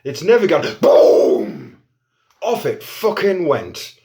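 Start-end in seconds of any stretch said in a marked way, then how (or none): 0.0-0.7 mocking crowd. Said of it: "people are saying to me". 0.8-1.8 loud breathy boom sound effect; imitated explosion boom. Flash pot finally explodes.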